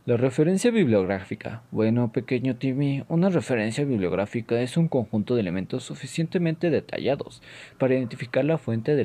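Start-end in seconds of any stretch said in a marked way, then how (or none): none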